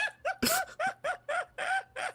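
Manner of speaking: high squawky cackle; chicken cackling. Real, not acted. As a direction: let out a hen-like cackle. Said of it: "That, that is legit chicken cackling".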